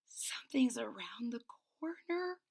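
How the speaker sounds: hushed, breathy whisper; soft secret whisper. Acted. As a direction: whisper so whatever's around the corner won't hear. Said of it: "whispered Francine".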